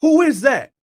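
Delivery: very high, loud challenge; yelling out. Retold or imitated. Man in the shower to unseen intruders, re-enacted yell. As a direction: shout it toward someone out of sight. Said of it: "So I just yell out".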